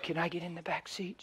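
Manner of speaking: hushed question; whisper. Retold or imitated. Preacher whispering to the woman in the car. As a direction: ask it in a whisper.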